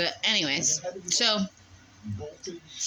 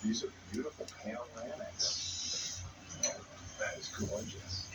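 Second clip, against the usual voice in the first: hushed, awed whisper; 8 semitones lower, 13 dB quieter than usual. Real, not acted. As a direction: breathe it out in wonder. Quiet awe at the view.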